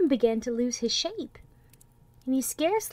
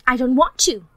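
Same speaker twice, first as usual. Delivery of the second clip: stubborn refusal; 3 semitones higher, 12 dB louder than usual. A narrator's reading. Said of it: "he said".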